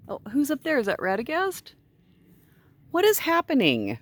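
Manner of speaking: high sing-song pet voice. Real, not acted. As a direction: coo up at the animals. High cooing at animals.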